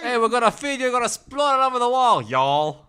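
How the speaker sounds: high, loud hammed-up Texan waiter; mock Texan-Italian. Waiter promising to feed diners, mock Texan holler.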